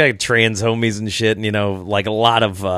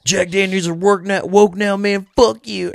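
a hammed-up gripe, voice pitched up; mocking angry guy; 7 semitones higher than usual. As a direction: parody a huffy customer, lightly. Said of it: "this guy's like".

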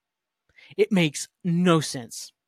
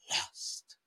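hushed title sting; dramatic whisper; 11 dB quieter than usual. Acted. Host caps the cliffhanger with the title, hushed whisper. Delivers the episode's ending title.

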